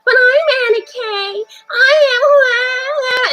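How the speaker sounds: put-on very high, slow, wailing girl voice. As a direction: cry it out in a high girl's voice. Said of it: "some girl voice saying".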